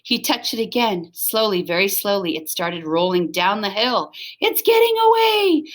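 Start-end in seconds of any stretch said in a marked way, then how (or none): 4.4-5.7 very high, loud yelp; dog yelping as the thing rolls off; alarmed yelp. Acted. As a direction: yelp it in a panic. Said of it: "yelped Alice".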